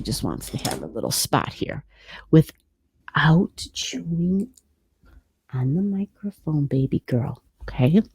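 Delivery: slow hushed whisper. Real, not acted. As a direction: whisper slowly to the cat. Whispering to cat near mic.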